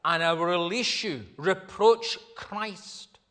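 loud, high, commanding proconsul; urging. A narrator's reading. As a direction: press the demand forcefully, word by word. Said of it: "the proconsul then urged him".

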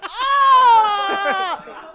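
high, loud mock shriek of shock. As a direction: scream in comic horror. Family shocked at joke.

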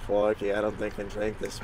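lightly put-on Australian accent, slipping Irish; Australian accent wandering toward Scottish. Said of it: "your Australian accent started turning, like, really Scottish and Irish".

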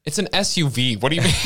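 replaying the outrage at the dealership, half-laughing; mock exasperation. To the dealership on the phone, imitating indignant disbelief. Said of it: "I was so mad".

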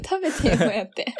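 teasing through laughter; laughing. Real, not acted. Laughing as she teases her cohost about toothpaste, laughing. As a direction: laugh while daring her to eat it.

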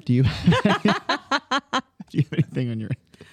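breaking into laughter mid-question; laughing. Real, not acted. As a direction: giggle through the words.

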